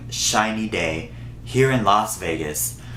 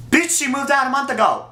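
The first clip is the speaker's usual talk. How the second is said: much higher, fast, incredulous outburst; outraged retort; 14 semitones higher, faster than usual. Real, not acted. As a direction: fire it back, scandalised and fast. Outraged retort to his claim.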